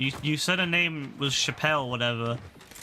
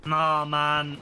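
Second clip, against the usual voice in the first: ordinary talk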